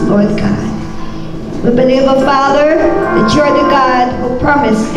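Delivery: usual speaking voice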